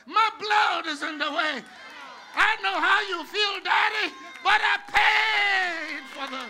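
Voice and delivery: high, loud shouted sermon; full-force preaching. Real, not acted. Preacher proclaims the blood to the congregation, shouting. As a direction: cry it out loud, stretching the last words.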